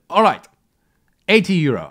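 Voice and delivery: loud, high seller counteroffer; mock seller dropping his price; seller haggling. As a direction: cave in like the seller. Seller drops price, retold.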